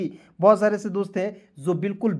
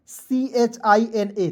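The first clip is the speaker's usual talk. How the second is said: spelling out slowly; much slower than usual. Shown as a demonstration. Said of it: "तो इसे पढ़ेंगे दोस्तों China".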